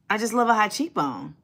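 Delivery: ordinary talk, nothing marked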